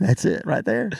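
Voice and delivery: lips barely moving, North Florida accent. Shown as a demonstration. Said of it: "that's a Florida accent from North Florida".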